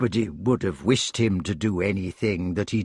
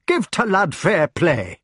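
raised, feisty rustic dialect; demanding hedgehog; 7 semitones higher, 3 dB louder, slower than usual. A narrator's reading. Hedgehog defending his hero from the committee, demanding. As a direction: square up and demand fair play. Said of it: "he demanded".

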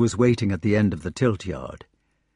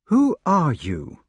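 slow, higher-pitched wondering question; wondering stranger; 6 semitones higher, slower than usual. A narrator's reading. Stranger questioning the knight he fought, wondering. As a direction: ask in slow amazement. Stranger amazed at his opponent.

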